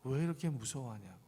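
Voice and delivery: usual speaking voice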